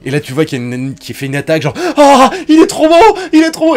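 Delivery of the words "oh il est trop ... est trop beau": voix très aiguë et forte, qui s'extasie lentement; pâmoison aiguë. Retold or imitated. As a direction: fondre d'admiration, en suraigu. Fille qui craque pour le héros. (une fan en extase devant le héros, criant d'une voix aiguë)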